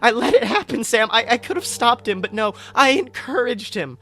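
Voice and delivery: spiralling into guilt, words tumbling out; grieving Hufflepuff blaming himself to his friend, high and panicked; panicked rant. Acted. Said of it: "full-blown panic attack".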